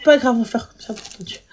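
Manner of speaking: usual speaking voice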